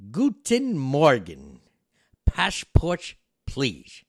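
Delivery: put-on loud, high German-accented greeting; German conductor voice. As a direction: boom it out like a gruff German conductor. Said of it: "he goes in his German".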